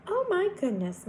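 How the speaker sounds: high-pitched Southern lady's dismay; concerned exclamation. A narrator's reading. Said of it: "Miss Manella exclaimed".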